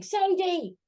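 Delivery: high-pitched mock call after someone; imitated shouting. Photographer chasing a woman he mistakes, mock shout. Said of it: "he started shouting".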